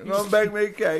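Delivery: lightly voiced mock baker, laughing; a hint of a cheery Betty Crocker. Teasing a friend with a mild mock Betty Crocker line. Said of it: "What are you, Billy Crocker?".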